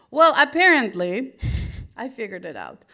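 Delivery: ordinary talk, nothing marked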